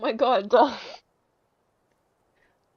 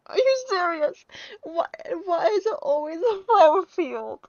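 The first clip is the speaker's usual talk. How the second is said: much higher and louder, slow disbelief; incredulous outburst; 8 semitones higher, 7 dB louder than usual. Real, not acted. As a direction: cry out in exasperated disbelief. Reacting to the game's ending.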